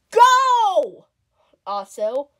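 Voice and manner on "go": shout. Acted. Starting the race.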